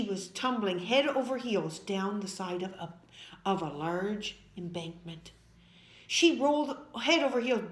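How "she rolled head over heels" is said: ordinary delivery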